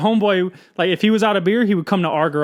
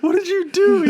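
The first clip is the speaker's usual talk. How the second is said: panicked brother; 13 semitones higher than usual. Retold or imitated. Retelling his brother's panic, high and laughing. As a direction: squeal it out in shock, half laughing.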